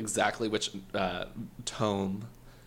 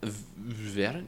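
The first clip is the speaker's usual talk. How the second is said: put-on slow, stretched Norwegian accent try; 3 dB quieter, slower than usual. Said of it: "I gotta work on a Norwegian accent".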